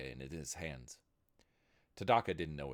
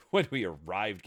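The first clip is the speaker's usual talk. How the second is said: loud; 3 semitones higher, 10 dB louder than usual. A narrator's reading. Interrupted repeat, louder.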